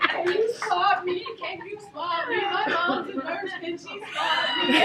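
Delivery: high, loud, slow, laughing while imitating a whining son; mock crying teen. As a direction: beg like a panicked sixteen-year-old, cracking up. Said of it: "He's crying".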